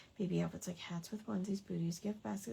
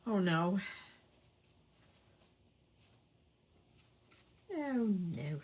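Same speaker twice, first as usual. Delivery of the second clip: talking through hiccups; holding back hiccups between words; suppressing hiccups. Real, not acted. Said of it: "I have the hiccups now".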